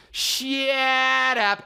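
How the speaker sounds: high, long-stretched shut up; absurdly drawn-out mock retort; exaggerated friends' imitation. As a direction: drag the hammy line way out. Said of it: "make it go so beyond".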